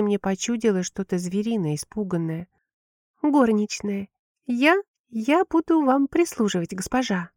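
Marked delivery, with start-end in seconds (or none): 3.2-7.3 high, timid stammering maid; frightened maid. A narrator's reading. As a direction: stammer it out, scared of the mistress. Frightened maid introduces herself.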